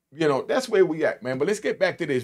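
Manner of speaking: ordinary talk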